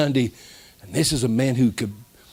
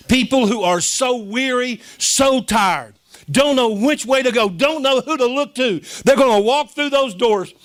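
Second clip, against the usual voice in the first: high, driving preacher's delivery; impassioned preaching; 8 semitones higher, 3 dB louder than usual. Real, not acted. Preacher promising the weary will walk through those doors, impassioned. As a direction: proclaim it to the congregation, pitched high.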